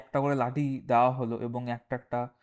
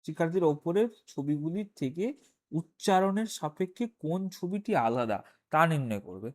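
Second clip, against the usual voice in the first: reading the exercise text in a slightly raised voice; measured textbook reading, a little higher; reading aloud; 5 semitones higher than usual. Acted. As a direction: read out the task from the page. Rereads task text from book.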